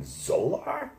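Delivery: high, rising cry of recognition; surprised celebrity spotting an acquaintance; Howard Stern greeting. Retold or imitated. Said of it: "and he went".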